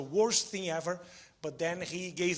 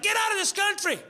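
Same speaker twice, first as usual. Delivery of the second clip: furious local; 9 semitones higher than usual. Retold or imitated. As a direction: imitate the raging guy at the store. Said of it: "He got furious at me".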